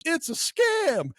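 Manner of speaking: high, long drawn-out mock wail; hammed-up alarm, far above normal pitch; mock alarmed cry. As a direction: cry it out high, stretching the last word. Wife's urgent text acted out.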